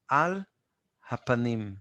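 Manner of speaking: normal talking voice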